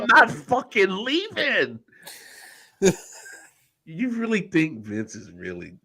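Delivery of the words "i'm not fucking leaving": drawn out, slow and higher, stubborn; slow mock defiance. Repeating the meme to friends, slow mocking drawl. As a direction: stretch every word like a stubborn kid.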